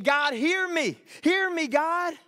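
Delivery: loud, high plea to God; crying out. Acted. Believer crying out to God about an issue, high and loud. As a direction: cry it out, desperate to be heard. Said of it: "you've been crying out to God".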